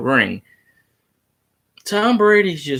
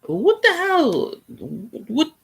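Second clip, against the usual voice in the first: drawn-out, much higher disbelief; incredulous; 7 semitones higher, slower than usual. Real, not acted. Streamer reads a baffling comment, slow high disbelief. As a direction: react as if the comment makes no sense, slowly.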